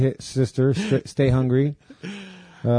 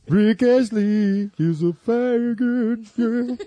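high mock crooner voice; high silly put-on voice; 9 semitones higher, slower than usual.

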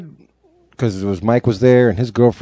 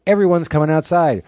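raised, stern mom threat; threatening mom; 8 semitones higher than usual. Retold or imitated. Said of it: "she's like".

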